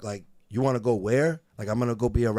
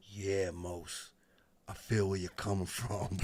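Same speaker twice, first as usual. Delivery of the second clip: lower, much softer diplomatic reply; 4 semitones lower, 10 dB quieter than usual. Retold or imitated. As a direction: keep a straight face, soft and low. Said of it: "[name] is back there like".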